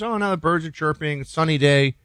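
lightly voiced guest enjoying sunshine; mock cheerful guest. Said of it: "Tornado goes".

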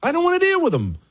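very high-pitched complaining whine; mock whiny protest. Host voices objection to dealing with them, high mock whine.

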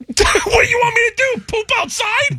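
high-pitched laughing protest. Real, not acted. Host laughing at co-host's backyard joke, high and squealing. Laughing banter about lactose intolerance.

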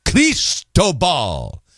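slow Cristobal, middle syllable Tobal stressed. Shown as a demonstration. Host repeating the guide's pronunciation slowly with middle stress. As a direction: draw out Cristobal, hitting Tobal. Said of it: "with an emphasis on the middle syllable".

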